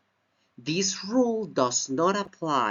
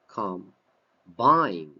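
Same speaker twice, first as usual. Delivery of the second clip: "Combine" with low "com", louder BINE; stress on second syllable; 5 semitones lower, 4 dB louder than usual. Shown as a demonstration. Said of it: "as a verb".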